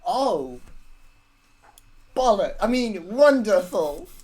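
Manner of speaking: loud outburst turned to fake cheer; hammed-up false delight; forced delight. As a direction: blurt it, then cover with overdone enthusiasm. Character covers dismay with false delight.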